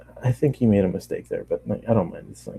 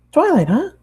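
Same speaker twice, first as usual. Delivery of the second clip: bubbly, much higher cartoon pony voice; high Pinkie voice; 4 dB louder than usual. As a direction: chatter it up high like an excited pony. Pinkie voiced in read story.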